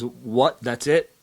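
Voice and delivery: ordinary delivery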